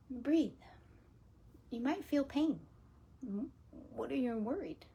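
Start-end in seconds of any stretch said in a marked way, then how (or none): none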